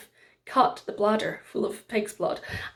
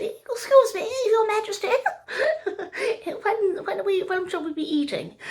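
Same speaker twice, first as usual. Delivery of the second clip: high, giggly lady at court; a high-pitched court lady laughing nervously; timid court lady; 11 semitones higher than usual. A narrator's reading. Court lady, giggling and high, asks the king about dinner.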